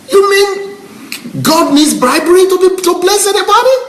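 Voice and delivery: very high-pitched incredulous question; real indignant outburst; emotional. Real, not acted. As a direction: voice leaping far up in disbelief. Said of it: "I'm getting emotional again".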